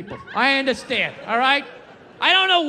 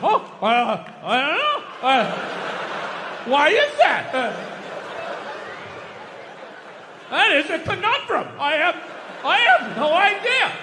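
mock dumbfounded. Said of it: "like, dumbfounded".

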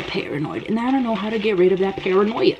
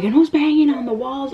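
high, alarmed mock question; retold freak-out; 8 semitones higher than usual. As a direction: act out panicky disbelief, pitched up. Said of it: "I was freaking out".

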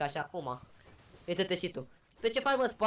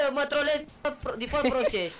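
indignant complaint; 7 semitones higher than usual. Real, not acted. Accuses teammate of trolling him.

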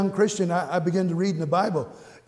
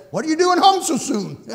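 wife's surprised question; 10 semitones higher, 5 dB louder than usual. Retold or imitated. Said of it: "Liz said".

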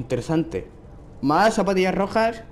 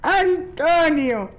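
high, long-drawn name call; 8 semitones higher, 3 dB louder, much slower than usual. Acted. Player hailing Antonio in the game, calling out.

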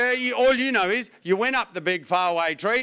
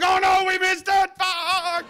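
put-on panicked old coot; 11 semitones higher, slower than usual. Neighbours panicking over the wrong bin. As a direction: gasp it in mock horror. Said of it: "They're like".

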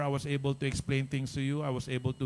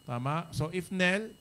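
ordinary talk

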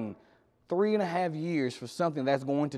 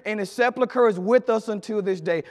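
usual speaking voice

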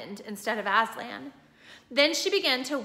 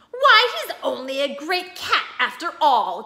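higher, louder jeering creature; mocking enemy; 4 semitones higher, 8 dB louder than usual. A narrator's reading. Creature jeering at shorn Aslan, higher and louder. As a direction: sneer at the shorn lion. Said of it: "cried one".

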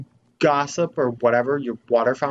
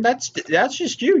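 high squeaky reassurance; 13 semitones higher, 3 dB louder than usual. Real, not acted. Reassuring odd NPC.

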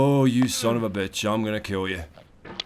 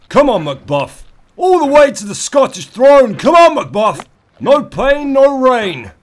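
high, loud battle-cry cheering; rallying cry; 10 semitones higher, 9 dB louder than usual. Acted. Gamer cheering on Macbuff like a battle cry, higher and louder. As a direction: roar him on to the throne.

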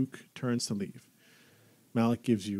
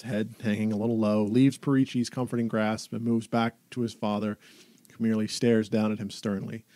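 normal talking voice

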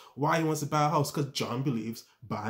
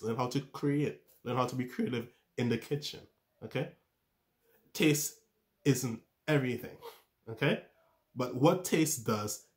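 normal talking voice

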